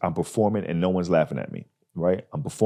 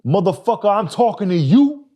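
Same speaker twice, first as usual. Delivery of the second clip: angry comeback; 12 semitones higher, 5 dB louder than usual. Retold or imitated. Comic angrily yelling back at a heckler. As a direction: snap back hard at the heckler. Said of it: "my instinct, I said".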